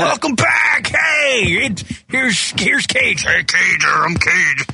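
mock radio host shouting; impression of Rock. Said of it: "pretend to be me".

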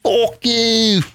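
mock Irish brogue, hammed up; Irish "fuck". Shown as a demonstration. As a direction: land the Irish "fook you". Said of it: "First, you gotta say "fuck".

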